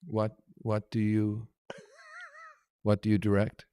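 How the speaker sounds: low, slow, puzzled impression; hesitant, drawn-out polite question; Warren Beatty. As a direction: feel your way slowly into the question. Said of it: "turns into me and he goes".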